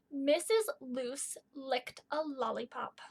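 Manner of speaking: Missus Loose licked a lollipop, each L word slow and clear; slow example reading. Shown as a demonstration. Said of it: "Let me give you an example".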